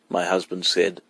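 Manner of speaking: plain reading voice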